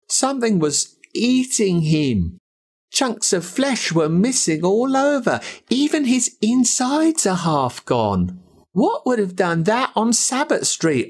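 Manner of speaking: lightly voiced grieving boy piling up the horror; upset boy friend. Narrator voices grieving friend Jolan.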